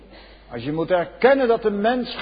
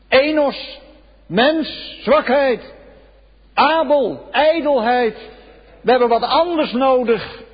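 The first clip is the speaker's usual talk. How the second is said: fervent cry; 7 semitones higher, 4 dB louder than usual. Acted. Voices the people's confession.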